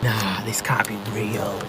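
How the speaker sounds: slow, hushed, breathy disbelief; disbelieving whisper. Real, not acted. Driver alone with the talking car, whispering in disbelief. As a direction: whisper it slowly, stunned.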